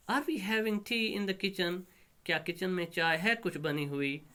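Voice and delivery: high-pitched daughter's question; put-on high voice querying mother. Daughter questions mother about tea.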